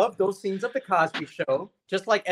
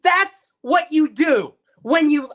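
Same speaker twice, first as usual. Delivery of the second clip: heated, raised-pitch interruption; worked-up anger; 5 semitones higher than usual. Real, not acted. Co-host halting the talk to rant, getting mad. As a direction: cut in hotly, then punch each word. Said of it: "I'm getting so mad".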